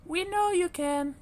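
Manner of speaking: very high little voices; chorus of tiny ducklings; ducklings encouraging. A narrator's reading. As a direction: siblings cheering on the smallest. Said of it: "said Widdle".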